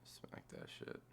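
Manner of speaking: hushed hype under the breath; whispering. Real, not acted. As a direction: whisper it eagerly to yourself. Hyped, to himself over trade.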